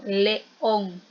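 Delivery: "león" drawn out very slowly, le and ón pulled apart; emphatic hiatus. Shown as a demonstration. Teacher stressing the two syllables for the class, "león" slowly split as le-ón. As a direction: stretch "león" so both vowels ring separately. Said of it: "Hacemos esa fuerza".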